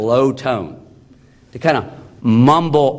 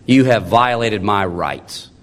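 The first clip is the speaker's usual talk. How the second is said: muttered accusation; 7 semitones lower than usual. Retold or imitated. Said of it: "You, you accuse them".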